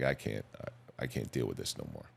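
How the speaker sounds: a man at the end of his rope, barely getting the words out; hushed and defeated; despairing. Retold or imitated. Retold despair alone at night.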